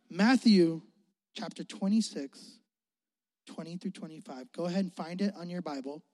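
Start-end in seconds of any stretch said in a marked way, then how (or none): none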